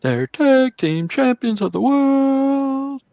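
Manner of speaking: booming ring announcer intro; ringmaster. Retold or imitated.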